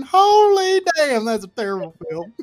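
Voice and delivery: high, loud, stretched-out disbelief; laughing rant; exasperated outburst. Real, not acted. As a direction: blurt it out laughing at how bad it is. Ranting about License to Kill.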